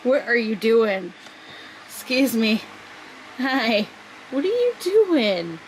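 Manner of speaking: slow, loud, high cooing; exaggerated sing-song pet talk; playful pet scolding. Real, not acted. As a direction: call out a naughty pet in drawn-out words.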